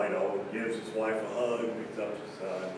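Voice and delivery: ordinary talk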